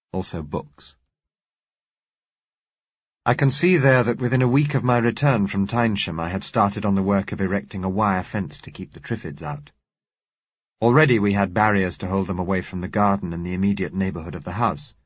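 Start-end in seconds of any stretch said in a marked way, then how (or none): none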